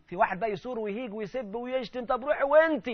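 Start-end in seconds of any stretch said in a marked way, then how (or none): 2.0-2.9 sharp, higher, louder retort; imitating angry husband. Preacher voicing a husband who loses his temper, angry. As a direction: fling the words back at her. Husband snaps back in quarrel.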